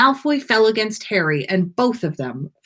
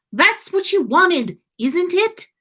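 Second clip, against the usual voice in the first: exasperated; 11 semitones higher than usual. A narrator's reading.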